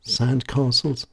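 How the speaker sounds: ordinary delivery, nothing marked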